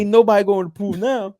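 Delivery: high, loud mock Tracy Morgan; Tracy Morgan impression. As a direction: quote Tracy Morgan with glee.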